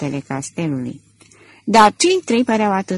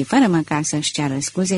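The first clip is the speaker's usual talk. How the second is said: ordinary delivery, nothing marked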